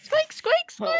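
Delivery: rubbery high squeaky toy; high squeaking. Acted. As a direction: squeak like a tiny rat. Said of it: "And you're squeaking again".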